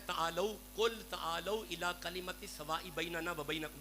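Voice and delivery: slow chanted recitation; Arabic verse intoned; Quranic recitation. Real, not acted. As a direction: recite the verse slowly, reverently.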